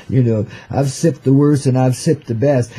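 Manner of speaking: ordinary talk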